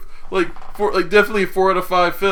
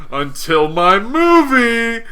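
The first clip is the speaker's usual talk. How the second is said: louder, higher, stretched-out proclamation; 8 semitones higher, 6 dB louder, much slower than usual. Retold or imitated. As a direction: swell into a mock triumphant cry.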